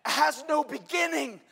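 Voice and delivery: loud, high, ringing sermon voice; full-force preaching. Acted. Pastor preaching to the congregation about God, loud and ringing. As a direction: proclaim it to the whole church.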